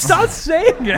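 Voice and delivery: high, drawn-out protest through laughter; laughing exasperation. Real, not acted. Co-host pleads with the catchphrase repeater, laughing and exasperated. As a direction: beg them to quit, half laughing.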